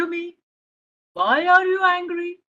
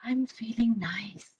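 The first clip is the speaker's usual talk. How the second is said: whisper; 4 semitones lower, 7 dB quieter than usual. Acted. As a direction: breathe the words out calmly. Child calming down, whispers.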